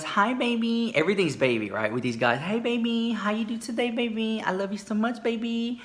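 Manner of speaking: put-on high, cooing baby talk; cooing sweet talk.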